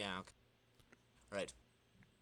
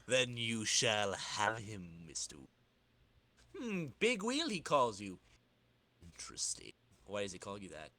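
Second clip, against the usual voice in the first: sinister tinkerer; 6 semitones lower, 4 dB quieter than usual. Acted. Tinkerer musing over his client's nickname, sinister and low. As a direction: purr it like a plotting villain.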